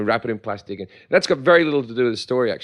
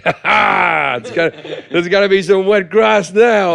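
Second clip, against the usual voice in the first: mock triumph; 5 semitones higher, 5 dB louder, slower than usual. Retold drunk vandal gloating.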